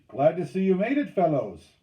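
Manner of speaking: loud, high teasing hail; calling out. A narrator's reading. Lapetite greets the late crew, calling out. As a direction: hail them from the beach. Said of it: "he called".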